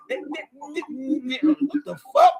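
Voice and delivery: high, slow, laughing in disbelief; cracking up at the memory. Real, not acted. Retelling shock, laughing.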